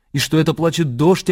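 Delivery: ordinary talk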